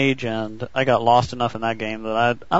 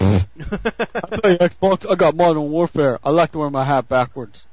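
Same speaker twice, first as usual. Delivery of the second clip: lampoon a bro gamer; mock bro voice; 3 semitones higher than usual. Mocking a friend's gamer persona.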